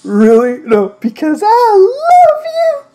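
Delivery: slow, drawn-out love line, pitched high at the end; mock romantic confession. Narrator declaring love to Markiplier, slow mock romance. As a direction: ham up the love line slowly.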